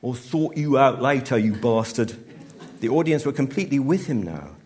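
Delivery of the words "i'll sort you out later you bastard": loud, raised Cockney threat; Cockney accent. A narrator's reading. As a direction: yell a Cockney threat toward the wings. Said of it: "his accent cockney all of a sudden".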